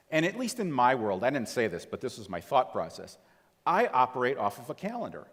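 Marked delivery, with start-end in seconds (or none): none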